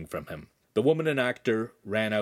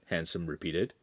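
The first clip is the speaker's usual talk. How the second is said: plain reading voice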